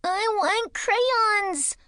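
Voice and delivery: put-on high, slow, whiny child wail; child screaming. A narrator's reading. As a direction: wail it like a furious toddler. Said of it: "Matthew screams".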